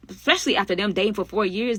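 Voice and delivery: usual speaking voice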